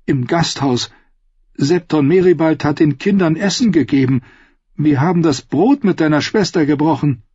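high, insistent protest; high, strained appeal; urgent pleading. Acted. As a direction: plead your case desperately. Brienne pleading guest right.

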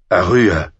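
loud call across the room. A narrator's reading. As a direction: call her name out loudly. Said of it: "rief Ned laut aus".

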